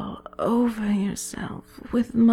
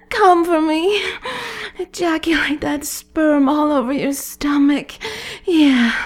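louder and higher, rising to a peak; building excitement; 4 semitones higher, 6 dB louder than usual. Acted. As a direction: push the energy up to climax. Countdown builds to climax.

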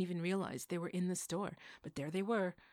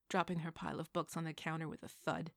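ordinary delivery

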